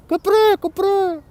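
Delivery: high mock bark with a French accent; French-accented dog bark. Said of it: "how dogs sound in French with French accents".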